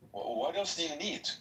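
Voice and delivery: ordinary talk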